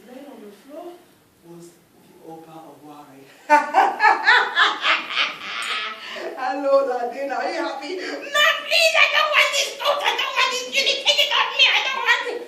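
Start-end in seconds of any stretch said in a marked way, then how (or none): 3.5-7.9 loud, high mocking cackle and greeting; a spirit mocking the trapped man; gloating taunt. Mocking captor taunts Oba. 8.0-12.4 high, loud desperate pleading; frantic begging. Acted. Oba begs to remove coat.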